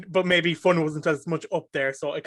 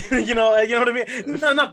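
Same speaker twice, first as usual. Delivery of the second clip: laughing; 6 semitones higher, 6 dB louder than usual. Real, not acted. Laughing at own joke.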